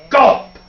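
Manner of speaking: sudden high, punched gulp; loud gulp. A narrator's reading. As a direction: pop out a big storybook gulp. Swallowing sound effect in story.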